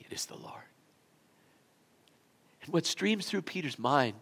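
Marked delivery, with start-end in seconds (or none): 0.0-0.6 breathless unvoiced hush; awed whisper. Disciples in the boat recognizing the Lord, awed whisper.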